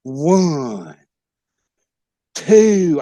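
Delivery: ordinary delivery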